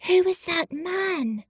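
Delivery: high, startled whispered question; sudden alarm, hushed. A narrator's reading. Colin spots a man, alarmed whisper. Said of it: "loud, suddenly alarmed whisper".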